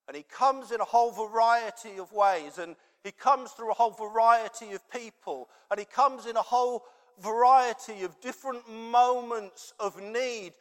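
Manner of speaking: preacher building to a climax; building sermon. Acted. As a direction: press the point home, growing. Preaching with rising force.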